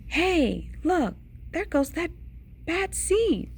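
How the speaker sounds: high-pitched onlooker's call. A narrator's reading. Onlookers spot the seed going by, high-pitched call. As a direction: pitch it up, pointing someone out.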